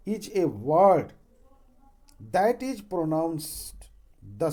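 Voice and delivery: dictating word by word, very slowly; slow dictation. Acted. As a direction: spell it out slowly for the class to write down. (धीमी आवाज़ में लिखवाना)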